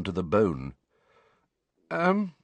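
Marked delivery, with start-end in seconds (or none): 1.9-2.3 higher, hesitant filler; nervous. A narrator's reading. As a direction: stall with mild nerves. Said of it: "said Neville nervously".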